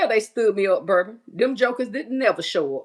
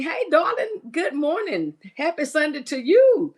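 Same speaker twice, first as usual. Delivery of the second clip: excited greeting; 7 semitones higher than usual. Real, not acted. Greeting a viewer by name.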